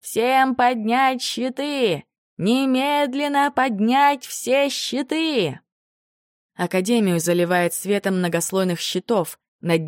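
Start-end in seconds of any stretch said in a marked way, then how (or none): 0.0-5.6 slow, high barked command; gruff barked parade orders. A narrator's reading. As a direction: bark the order to the crowd. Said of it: "кричит голосом ректора Дегона".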